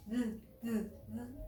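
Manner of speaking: "d" as short repeated taps; letter sound. Shown as a demonstration. Teacher gives children the D sound, isolated. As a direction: say "d" as a sound, not a name. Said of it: "La D".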